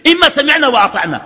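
high-pitched, emphatic first alternative; the obedient option. Shown as a demonstration. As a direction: pitch it high, then let it fall. Sets out two opposed choices.

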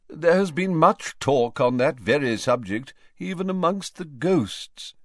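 Nearly Headless Nick, pompous. A narrator's reading. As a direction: butt in as a self-important ghost.

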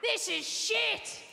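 high, mock shrieking heckler. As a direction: squeal it like a demanding heckler. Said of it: "She's like".

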